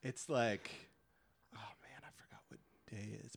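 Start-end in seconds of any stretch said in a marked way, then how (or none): 1.5-3.3 fast, whispered aside to self. Real, not acted. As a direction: whisper it to yourself quickly. Aside while reading poster.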